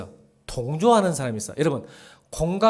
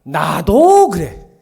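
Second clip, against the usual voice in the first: slow, drawn-out, louder; me too; 2 semitones higher, 12 dB louder, much slower than usual. Shown as a demonstration. Pastor slowly acting out the wrong kind of agreement. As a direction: stretch out me too. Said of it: "주어가 어떻게 바뀌었어?".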